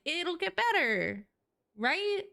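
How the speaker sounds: high, slow hopeful lilt; sing-song reassurance to herself; high hopeful self-pep talk; hopeful optimist. Retold or imitated. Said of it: "that's like".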